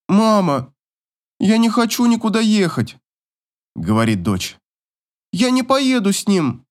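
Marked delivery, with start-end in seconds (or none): 0.1-3.0 put-on high, pleading girl's voice; daughter's plea. A narrator's reading. Daughter to mother, refusing to go, high and pleading. 5.3-6.6 put-on high-pitched protesting girl; daughter's refusal. A narrator's reading. Daughter refuses to go with father, high protest.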